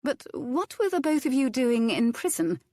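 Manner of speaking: high startled questions; shocked lady. A narrator's reading. Violet startled by the cook's prison past, high. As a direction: gasp it out in disbelief.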